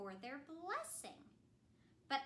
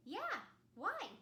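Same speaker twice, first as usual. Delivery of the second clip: high silly voice; cheerful dopey reply; goofy snowman; 7 semitones higher than usual. Acted. Snowman answering Anna, goofy voice.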